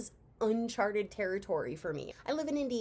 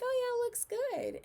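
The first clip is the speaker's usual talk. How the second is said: squeaky high, bright "oh yeah" about the eyebrows; impressed approval. Retold or imitated. Teen admiring her aunt's fresh eyebrows, high squeal of approval. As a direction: chirp it way up like you're pleased. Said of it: "and I'm like".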